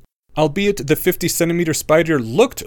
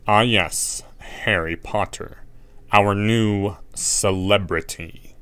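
sneering; 2 semitones lower, much slower than usual. A narrator's reading. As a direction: drawl it with contempt. Snape sneers at Harry.

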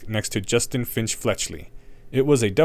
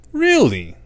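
incredulous; 11 semitones higher, 6 dB louder than usual. A narrator's reading. Harry challenges Snape.

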